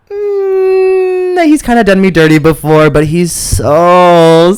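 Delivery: high, loud swooning inner voice. Retold or imitated. To herself about the guy, high loud lovesick gushing. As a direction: gush about him, lovestruck and breathy.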